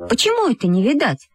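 high, puzzled question; surprised. Acted. Valka hears they get no tickets, surprised. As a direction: ask it taken aback. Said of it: "удивилась Валька".

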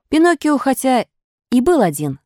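usual speaking voice

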